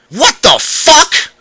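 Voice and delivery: high, loud, slow alarmed cry; falling through nothing, crying out in alarm; cried out in alarm. A narrator's reading. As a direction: yell as the floor vanishes. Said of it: "I cried out in alarm".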